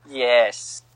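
ordinary talk

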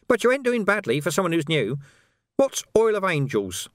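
high, sly, wheedling urchin voice; high-pitched cockney urchin hustling a copper; cheeky street urchin. Acted. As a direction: haggle like a grubby street kid. Urchin Nobby's voice.

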